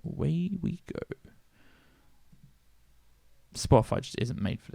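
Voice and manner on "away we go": ordinary talk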